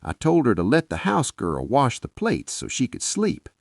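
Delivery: usual speaking voice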